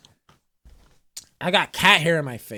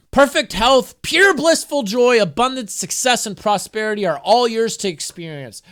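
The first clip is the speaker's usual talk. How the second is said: higher, louder inspirational read; uplifting affirmation reading; 5 semitones higher, 4 dB louder than usual. Acted. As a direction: spiritual oracle-card proclamation. Reads affirmation card aloud.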